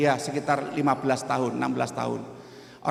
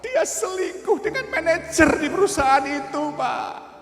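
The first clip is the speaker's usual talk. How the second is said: put-on high, tearful voice of a heartbroken young man; 11 semitones higher, slower than usual. Heartbroken young man tells the pastor he was betrayed, tearful. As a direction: plead through tears, pitched up. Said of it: "Dia nangis".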